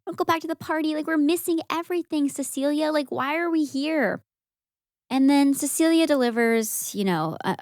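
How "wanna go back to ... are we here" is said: whiny bored friends. Retold or imitated. Friends whine to leave the tower.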